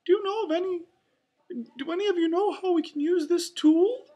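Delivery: high, loud, fast mouse falsetto; Mickey. A narrator's reading. Mouse asking his helper for a tool, quick squeaky voice. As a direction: ask eagerly in a squeaky cartoon voice. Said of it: "Mickey asked".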